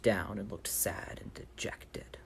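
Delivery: soft whispered sad aside. A narrator's reading. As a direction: let it sink into a dejected whisper. Describing faking sadness.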